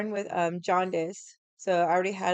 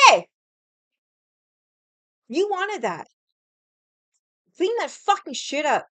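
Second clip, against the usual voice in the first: retold furious shout at grandpa, much higher and louder; angry yell; 9 semitones higher, 7 dB louder than usual. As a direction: bark it at him like scolding a child. Yelling at grandpa over thrown plate.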